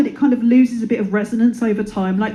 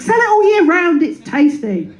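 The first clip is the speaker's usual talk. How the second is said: whiny demand; 9 semitones higher than usual. Retold or imitated. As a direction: beg like a spoiled coffee fan. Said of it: "It's like".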